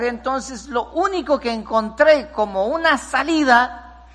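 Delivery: high, slow, pleading lament; tearful drunk man. Retold or imitated. Drunk man tells the pastor his pain, tearful and high. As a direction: voice him near tears, begging for help. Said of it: "y lloró".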